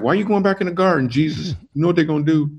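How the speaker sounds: high, quick, exasperated plea; pleading with Jesus. Retold or imitated. Reading the Gospels and pleading with Jesus, high and quick. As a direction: beg him not to go back. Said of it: "I'm like".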